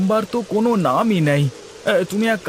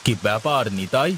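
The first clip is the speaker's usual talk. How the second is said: lower, heavier landlord voice; zamindar voice; 6 semitones lower, 3 dB quieter than usual. Acted. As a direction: speak down as the village landlord. Same actor voices the zamindar.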